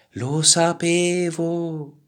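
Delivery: slow, high cry stretched out; drawn-out high call. A narrator's reading. Turtle heard from the clouds, drawn-out high call. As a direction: call it long from far above.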